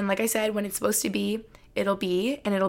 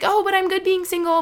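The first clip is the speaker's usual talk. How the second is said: much higher, brighter insisting voice; mock reassurance; 10 semitones higher, 3 dB louder than usual. Vlogger mimicking her past self, high and insistent. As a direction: play it up high, pretending to be fine. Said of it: "be like".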